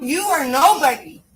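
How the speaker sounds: slow, loud, spitting each word; contemptuous shout. Real, not acted. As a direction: hammer him down word by word. Arrestee berating the officer.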